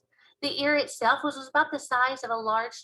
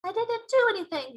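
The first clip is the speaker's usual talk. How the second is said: high-pitched indignant denial; protesting innocence; 11 semitones higher, 4 dB louder than usual. Acted. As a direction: protest that you are blameless, voice up.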